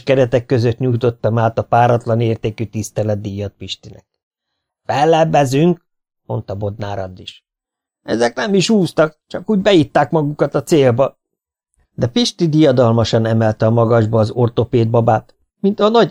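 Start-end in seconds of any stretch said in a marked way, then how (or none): 4.8-5.9 high, drawn-out objection; formal protest. A narrator's reading. Losing swimmer appeals the result, protesting. 8.0-11.2 high-pitched sore-loser complaint; indignant protest. A narrator's reading. Losing swimmer accuses winners, indignantly. Losing team disputes the result.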